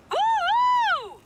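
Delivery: long, high, loud woo-hoo; party whoop. Acted. Party cheer.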